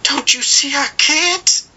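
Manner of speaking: high, slow, pleading cry of a desperate mother. A narrator's reading. Mother to her son, crying out high and slow. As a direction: beg through tears, drawn out. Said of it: "his mother cried".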